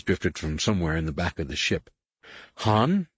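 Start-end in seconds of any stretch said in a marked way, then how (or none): 2.6-3.1 higher-pitched call, only slightly raised; mild shout. A narrator's reading. Leia calls to Han in the back, mild shout. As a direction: call out questioningly, without a full shout. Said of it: "Leia shouted".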